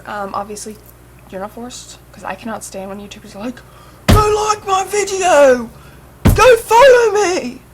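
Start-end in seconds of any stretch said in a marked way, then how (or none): 4.1-7.6 loud, high, drawn-out mock accent yell; mock YouTuber accent. Vlogger mocking pushy YouTubers, shouting in a put-on accent. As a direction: wail it slowly in an exaggerated put-on YouTuber accent. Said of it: "I did that in an accent".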